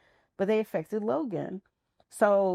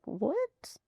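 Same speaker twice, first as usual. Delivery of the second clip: high-pitched retold disbelief; retold surprise; 10 semitones higher, 4 dB quieter than usual. Mother reacts to daughter's godmother request, retold surprise. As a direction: replay a taken-aback question, high.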